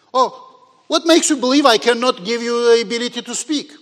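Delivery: loud, high indignant questioning. Retold or imitated. God challenging Moses' doubt about speaking, indignant. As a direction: demand it like an offended God. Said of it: "God gets indignant and says".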